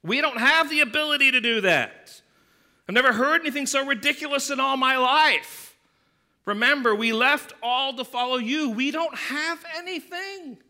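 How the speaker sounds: loud, high, incredulous protest; exasperated disbelief. Retold or imitated. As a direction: push back like it's ridiculous. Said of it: "So basically what they're saying is this".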